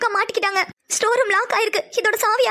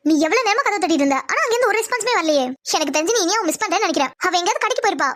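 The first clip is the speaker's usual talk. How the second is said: ordinary talk, nothing marked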